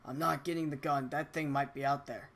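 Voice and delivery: ordinary delivery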